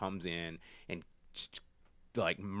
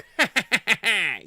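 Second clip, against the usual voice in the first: loud, high evil cackle; evil villain cackle; 12 semitones higher, 12 dB louder than usual. Retold or imitated. Skeletor laughs after the line.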